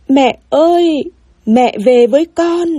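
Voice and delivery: high, drawn-out call for mum; child's wail. A narrator's reading. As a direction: plead loudly for mother to come back. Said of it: "nó lại gào lên". (giọng trẻ con gào gọi mẹ, kéo dài)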